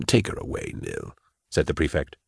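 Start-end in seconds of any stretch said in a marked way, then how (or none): 0.1-1.1 soft, breath-heavy command; hushed, weary authority; quiet order. Acted. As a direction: dismiss them almost in a whisper. Prefect quietly orders her removed.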